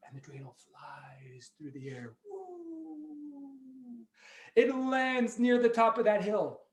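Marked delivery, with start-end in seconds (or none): 2.3-4.1 high, soft sliding "woo"; long falling whistle-like woo; flying whoosh. Pretend. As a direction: imitate something sailing through the air. Imitates dreidel flying through air.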